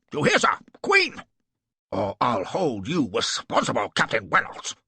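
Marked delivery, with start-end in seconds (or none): 0.8-1.2 very high, loud lisped "Queen!" for clean; shouted "Queen!". A narrator's reading. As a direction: shout "Queen!" as a final order. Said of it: "snapped Cardigan".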